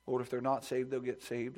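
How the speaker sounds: usual speaking voice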